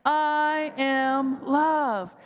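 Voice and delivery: I am love drawn out very slowly; very slow affirmation. Shown as a demonstration. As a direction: linger on every word, fully sincere. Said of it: "Let's say it again".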